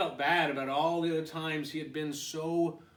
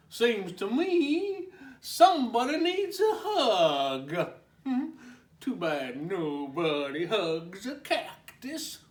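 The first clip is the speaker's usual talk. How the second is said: 1 chuckling cowboy; 7 semitones higher, 8 dB louder than usual. Acted. Cowboy teasing laughing.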